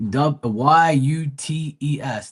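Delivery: slow, letter by letter; spelling out. Shown as a demonstration. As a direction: spell it out slowly, each letter separate. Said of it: "That's how they spell it".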